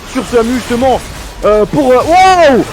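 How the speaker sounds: excited casting. Real, not acted. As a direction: hype play-by-play shout. Teamfight play-by-play climax.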